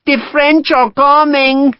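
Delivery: high, slow sing-song mockery; mocking. A narrator's reading. The Marquesa mocks Sharp, high slow cackling crone. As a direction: crow it at him like an old witch. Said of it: "the Marquesa mocked".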